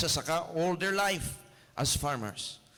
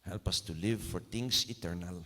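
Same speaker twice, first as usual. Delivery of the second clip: soft, low, fading prayer; 5 semitones lower, 4 dB quieter than usual. Acted. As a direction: pray quietly to the Lord, winding down. Closing prayer. (mahinang panalangin)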